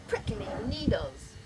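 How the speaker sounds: ordinary delivery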